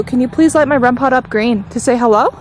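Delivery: usual speaking voice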